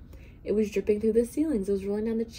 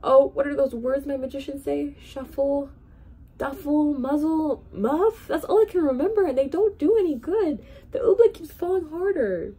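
put-on high, louder, helpless old king voice; a panicky monarch pleading upward; fretful groaning king; 9 semitones higher, 7 dB louder than usual. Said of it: "groaned the king".

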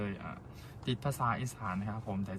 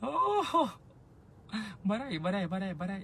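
higher, quick laughing no no no; playful refusal; 9 semitones higher, 5 dB louder than usual. Real, not acted. Singer laughingly refusing the lip-bite pose for TikTok. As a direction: bat the request away with a grin.

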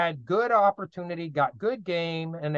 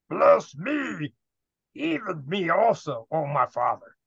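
bitter cry. Acted. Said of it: "cried out with an exceedingly great and bitter cry".

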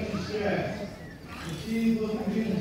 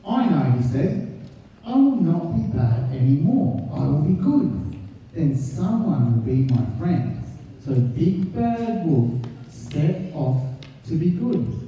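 put-on lively read-aloud voice for children; story narration; 4 semitones lower than usual. A narrator's reading. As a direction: read the picture book to the class. Teacher reads picture book aloud.

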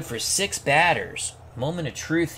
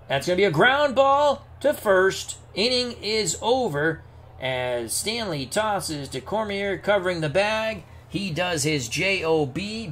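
raised announcer voice, punching the big moments; live play-by-play; 4 semitones higher, 3 dB louder than usual. Pretend. Announcer calling the pitch to Earl Combs, live play-by-play. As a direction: call the decisive at-bat for the crowd.

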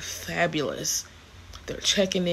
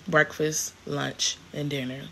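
ordinary delivery

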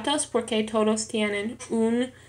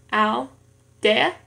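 "aldea" in two pieces, al, dea; syllable by syllable; 3 dB louder than usual. Shown as a demonstration. Learner showing two syllables in an exercise, "aldea" split apart. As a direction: split "aldea" with a pause after "al". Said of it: "en dos sílabas".

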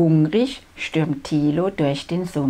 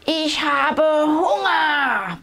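slow, high roaring dino bellow; roaring hunger; 9 semitones higher, 3 dB louder, slower than usual. A narrator's reading. Hungry dinosaur roaring at the swamp, slow roar. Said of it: "brüllt Tilo".

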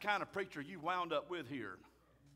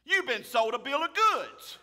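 emphatic rebuke; 5 semitones higher, 7 dB louder than usual. Real, not acted. Preacher rebukes congregation.

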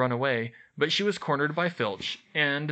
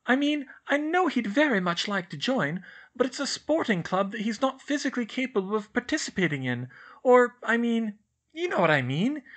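put-on higher, far brighter girl's voice, fussy and earnest; Hermione voice; 9 semitones higher than usual. A narrator's reading. Narrator voices Hermione complaining to Ron and Harry, higher girl voice. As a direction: play a know-it-all schoolgirl fretting.

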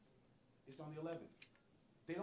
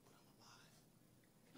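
slow breathy whisper; mock-tragic whisper, very slow; dramatic whisper; 24 dB quieter, much slower than usual. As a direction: hush it out like a tragic confession. Acting R. Kelly's dramatic interview line.

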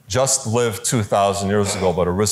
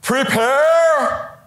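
booming call; much slower than usual. Retold or imitated. Priest in Los Angeles calling out to his congregation, booming. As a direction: ring it out across the church. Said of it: "He went like this".

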